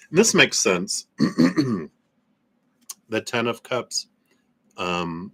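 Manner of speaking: ordinary talk, nothing marked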